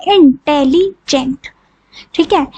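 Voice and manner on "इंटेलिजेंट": इंटेलिजेंट slowly, each syllable apart. Shown as a demonstration. Teacher counting sounds for students, इंटेलिजेंट slowly syllable by syllable. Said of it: "चार sound आपकी आ रही है".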